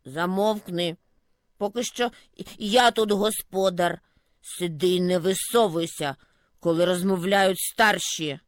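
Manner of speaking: put-on low, bossy old grumble; gruff snowdrift. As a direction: put the youngster in its place. Snowdrift scolds the sprout. (грубувате бурчання старого замету)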